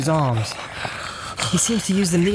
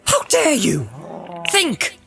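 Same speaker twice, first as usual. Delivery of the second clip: higher-pitched, commanding rebuke; stern warning; 7 semitones higher than usual. A narrator's reading. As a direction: challenge the captive sternly, with emphatic warning. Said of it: "his words and his stern voice".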